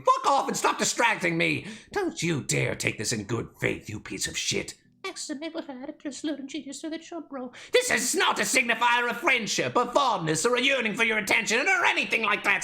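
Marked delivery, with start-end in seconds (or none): none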